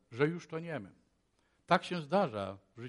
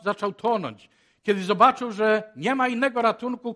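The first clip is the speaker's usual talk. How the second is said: ordinary talk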